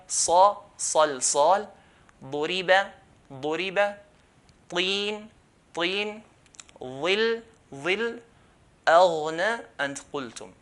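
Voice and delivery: put-on first letters of "duriba, teen, dhil" thick and heavy. Shown as a demonstration. Teacher drilling example words, heavy manner. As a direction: raise the tongue's back for "sa, sal, duriba, teen, dhil, aghna, qultum". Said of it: "then we pronounce it heavy".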